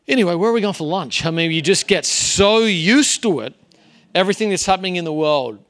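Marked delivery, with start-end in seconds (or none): 0.1-1.2 mock indifference. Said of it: "you're just like".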